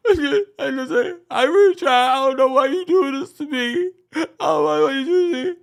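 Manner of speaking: mock bawling in a very high, loud squeal; Quad. Host imitating a castmate who starts crying, mock bawling. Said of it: "She starts bawling".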